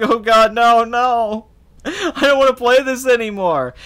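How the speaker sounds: high, loud mock pleading; hammed-up panic. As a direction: beg to stop like a terrified player. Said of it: "be like".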